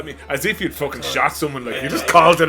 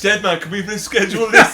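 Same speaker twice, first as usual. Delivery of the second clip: high, laughing pretend plea; mock plea; 6 semitones higher, 5 dB louder than usual. Kid pleading with Dead Man, high and laughing. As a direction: beg the chaser politely, laughing. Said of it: "It's like".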